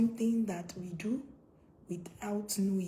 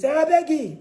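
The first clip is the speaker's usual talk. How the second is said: loud, high, impatient cut-off; acted exasperated shout; dismissive parent; 7 semitones higher, 13 dB louder than usual. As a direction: burst out to brush off the child. Acts out dismissive parent's reply.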